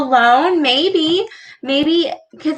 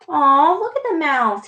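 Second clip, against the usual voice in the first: ordinary delivery, nothing marked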